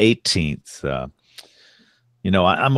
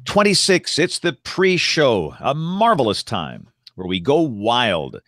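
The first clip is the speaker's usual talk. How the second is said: announcer voice; 4 semitones higher than usual. Acted. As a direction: open the show like a radio host. Show intro, host opening voice.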